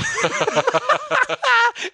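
squealing laugh up high; high-pitched laugh. Real, not acted. Laughing at the joke.

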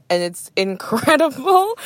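drawn-out laughing incredible, rising; laughing delight. Real, not acted. Podcaster raving about Airline UK, laughing. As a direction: gush through a laugh.

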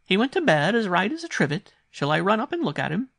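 high-pitched, loud governess on the phone; startled governess. A narrator's reading. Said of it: "terribly surprised and startled".

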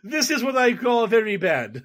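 high hammed Russian accent; mock Russian accent. Joking about the Rounders villain, mock Russian accent. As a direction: lay on a thick fake Russian accent.

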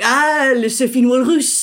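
imitated French "ah" of recognition, amused; French barman. Bartender finally understanding the beer order, imitated French accent. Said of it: "he was like".

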